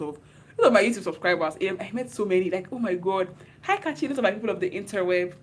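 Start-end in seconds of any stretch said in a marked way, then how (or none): 3.6-4.1 mock fan, high and bright; imitating a starstruck fan; fans' high greeting. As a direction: squeal hi like an excited fan. Fans greeting her excitedly.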